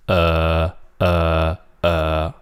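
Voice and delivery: low, level, drawn-out UH; hesitating. Shown as a demonstration. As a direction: hold UH low and even, as if hesitating. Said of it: "when you're hesitating".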